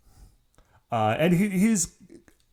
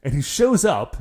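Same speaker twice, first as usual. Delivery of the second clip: ordinary talk, nothing marked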